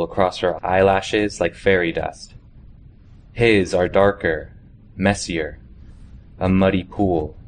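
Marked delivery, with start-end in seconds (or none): none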